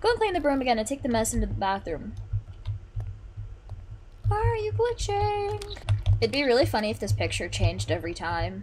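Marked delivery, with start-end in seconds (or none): 4.3-5.8 high, slow, unsettled; creeped out. Real, not acted. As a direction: ask it slowly, spooked. Character glitches.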